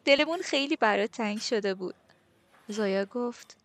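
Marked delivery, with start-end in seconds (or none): 0.1-2.0 higher, louder excited squeal; gushing, high-pitched greeting. A narrator's reading. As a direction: squeal it like a delighted friend. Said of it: "نادیا جیغ جیغ کرد".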